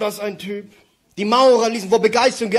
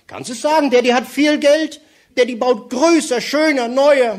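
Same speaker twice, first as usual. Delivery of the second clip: eager daddy; 6 semitones higher, 4 dB louder than usual. Acted. Daddy voiced in a joke.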